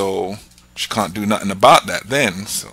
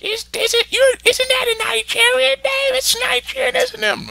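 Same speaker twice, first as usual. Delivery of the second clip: falsetto mock woman, far higher; imitating a woman. Woman realises his name is Nigerian, hammed-up falsetto. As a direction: squeal it like a nosy lady who caught you out. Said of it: "one woman's like".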